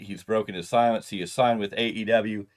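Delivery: normal talking voice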